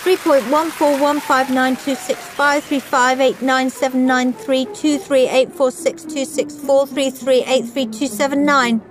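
high, even-pitched recitation; clever girl reciting. A narrator's reading. Clever Claire reciting pi on stage, reciting. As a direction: rattle off digits in a high schoolgirl voice. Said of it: "said Clever Claire".

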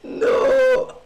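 high, loud no that cracks; voice cracking. Real, not acted. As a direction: let the voice crack on the shout. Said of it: "Voice crack".

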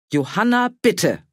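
loud, sharp call to order; aunt silences her shouting niece; energetic reprimand. Acted. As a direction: stop her niece firmly. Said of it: "Fienchens energische Stimme".

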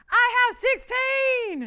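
slow, high, loud preacher-style proclamation; Sunday service preacher. Real, not acted. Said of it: "was feeling like I was in Sunday service".

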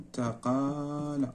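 slow and soft; استقال drawn out slowly and quietly; slow dictation. Shown as a demonstration. Teacher dictating the verb to the class, slow and soft. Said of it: "استقال نفس الشيء مزيد".

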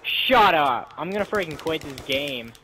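yelled angry gamer rant; raging gamer. Real, not acted. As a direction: snap at friends, then threaten to quit. Raging gamer yelling at friends.

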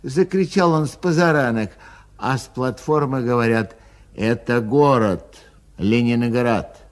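ordinary delivery, nothing marked

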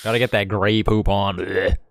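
normal talking voice

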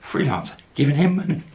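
high, loud incredulous exclamation; amused disbelief. Real, not acted. Storyteller marvels that she gives him money, loud amazement. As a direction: marvel at it, pitch leaping up.

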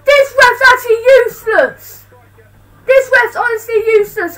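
loud, high complaint at the ref; angry rant. Real, not acted. Fan ranting at the referee, loud and fed up. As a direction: vent at the useless referee.

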